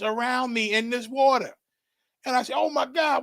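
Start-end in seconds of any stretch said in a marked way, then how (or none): 2.6-3.2 recalling a panicked shout in the vision; panicked cry. Retold or imitated. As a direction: cry out oh my God as the water closes in. Crying out drowning in vision.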